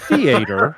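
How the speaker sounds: THEATER said loud, in a deliberately odd way; deliberate mispronunciation. Real, not acted. Coach teasing the panel mid-read, deliberate mispronunciation of "theater". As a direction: punch out "theater" as a knowingly silly mispronunciation. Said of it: "He did that on purpose".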